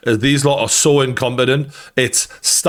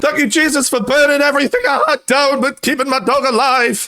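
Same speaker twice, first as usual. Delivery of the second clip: much higher hammed-up cry of thanks to Jesus; mock grateful owner. Mocking the tearful dog owner.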